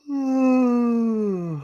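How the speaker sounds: long, high sigh trailing downward; exasperated sigh, slowly sliding down. Real, not acted. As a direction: heave a drawn-out, high-pitched sigh. Reacting while recalling boring interview.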